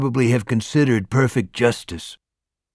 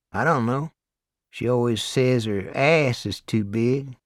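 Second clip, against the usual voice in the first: puzzled Southern inmate, mildly; lightly Deep South; 2 semitones higher than usual. A narrator's reading. Duck unsure about his wife, light Southern accent. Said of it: "Deep South accent".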